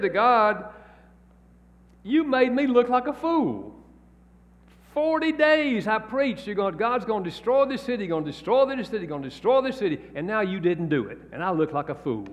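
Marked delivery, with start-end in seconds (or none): 2.0-12.3 high, whining protest to God; a prophet who feels made a fool of, pitched up high; complaining Jonah. Retold or imitated.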